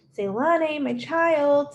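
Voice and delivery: a convincing mother's call; high womanly voice. Acted. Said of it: "in a high, womanly voice".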